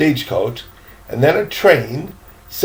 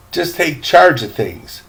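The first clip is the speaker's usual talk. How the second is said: ordinary delivery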